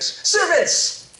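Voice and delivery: high, loud, joyful calling out; father shouting to servants to prepare a feast; joyful yell. Acted. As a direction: shout orders across the house in delight. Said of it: "he yelled out to a servant".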